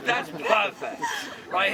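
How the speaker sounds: usual speaking voice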